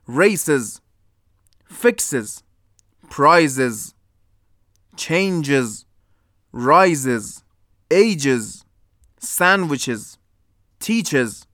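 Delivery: each word from "races" to "teaches" ends in a voiced "iz"; extra "ez" syllable. Shown as a demonstration. Teacher lists sibilant examples, each with an added iz syllable.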